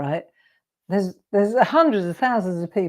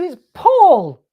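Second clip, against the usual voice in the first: exasperated reveal; 9 semitones higher, 10 dB louder than usual. Retold or imitated. Teller revealing to the guy.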